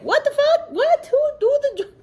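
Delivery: squeaky what-the-hell shock, a laugh breaking in; incredulous. Real, not acted. Ranting about chores, she squeaks in sheer disbelief. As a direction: pitch shoots far up in disbelief.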